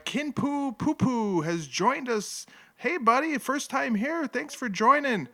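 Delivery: high, bright welcome to a new viewer; welcoming. Real, not acted. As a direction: greet the newcomer cheerfully. Welcoming a new chat member.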